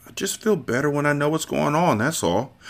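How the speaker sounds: soft sheepish mumble; mumbling. Acted. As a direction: mumble it under your breath. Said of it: "the Turk mumbled".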